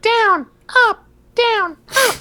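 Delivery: put-on high childish commands; little kid voice. Boss's kid ordering recruits through push-ups, high childish voice. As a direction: bark counts like a small kid. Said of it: "his little kid be like".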